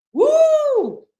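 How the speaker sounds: loud, very high, sustained woo; screaming whoop. Real, not acted. The silly name is making her scream, loud high whoop. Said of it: "it's making me scream".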